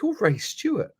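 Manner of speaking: high, disbelieving question; stunned surprise. Retold or imitated. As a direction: gasp out his name in astonishment. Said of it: "I just went".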